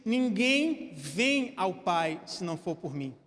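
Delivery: very slow, each word weighted; slow solemn verse; slow weighty quote. Shown as a demonstration. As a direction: drag it out so every word lands. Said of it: "Jesus disse".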